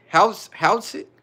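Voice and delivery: "How's, how's it?" tried slowly and unsurely, restarting the word; struggling to pronounce. Real, not acted. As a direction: sound out "How's, how's it?" haltingly as HOWTS-it. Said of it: "I don't know how to say that shit".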